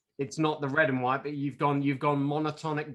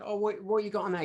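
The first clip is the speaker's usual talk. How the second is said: much higher, fast and keen; eager music snob; 8 semitones higher, faster than usual. Pretend. Record-shop snob asking about music, eager and quick. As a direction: quiz a friend about his records. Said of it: "intellectual people would listen".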